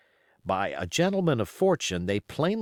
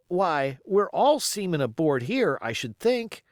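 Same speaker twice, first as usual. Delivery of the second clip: put-on higher, eager young seaman won over; a lad sealing the deal, higher and keen; young sailor Dick; 6 semitones higher, 3 dB louder than usual.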